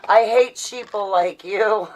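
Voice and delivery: slow, loud, sneering rant at the masked; hammed-up conspiracy poster ranting; loud, slow mocking rant; mock online rant. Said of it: "I write like".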